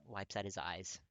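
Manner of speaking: ordinary delivery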